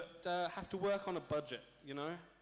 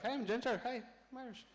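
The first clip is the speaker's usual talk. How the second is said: hammed-up Irish accent, pitched higher; a cheesy mock Irish accent, pitched up for a joke; fake Irish accent; 8 semitones higher than usual. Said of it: "I could pass for Irish. I could go like this".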